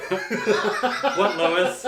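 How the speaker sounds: high, loud, breaking into laughter; laughing through a Queens accent. Retold or imitated. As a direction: try a Queens accent and crack up. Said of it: "I can't do it".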